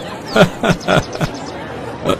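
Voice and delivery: quiet breathy chuckle; soft chuckle. Acted. Customer chuckling at the shopkeeper's joke, quiet. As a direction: laugh off the joke softly.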